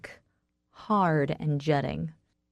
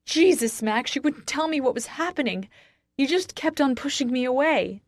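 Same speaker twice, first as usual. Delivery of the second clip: high-pitched, anguished outburst; scream; 7 semitones higher than usual. A narrator's reading. Wife blaming husband for pushing her away, screaming. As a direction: cry out the hurt, voice raised. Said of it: "I scream".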